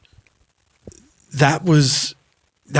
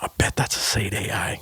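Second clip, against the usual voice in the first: muttered aside; 4 semitones lower, 9 dB quieter than usual. Retold or imitated. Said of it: "And I went".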